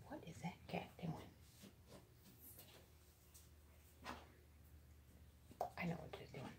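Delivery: soft whisper aside. Real, not acted. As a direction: hush it under your breath. Aside to the cat.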